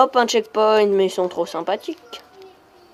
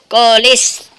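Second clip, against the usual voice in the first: loud Québécois swear; playful, drawn-out Québécois oath; Québécois accent; 2 semitones higher, 9 dB louder than usual. Pretend. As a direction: curse loudly in a put-on Québécois accent. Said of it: "je vais arrêter avec mon accent québécois".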